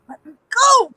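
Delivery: high mock-desperate plea; acted struggling plea. Held captive and struggling to get free, high acted plea.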